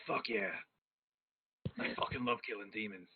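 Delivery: low, soft, understated declaration; low-key relish. Acted. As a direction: say it low and quiet, savouring it. Priest's low reply, catchphrase again.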